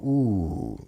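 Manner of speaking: ordinary talk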